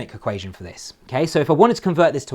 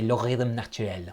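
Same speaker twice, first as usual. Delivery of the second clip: lightly attempted French accent, quieter; bad French accent; 3 semitones lower, 8 dB quieter than usual. Shown as a demonstration. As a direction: say it with a rough, approximate French accent. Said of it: "Sorry for my bad accent".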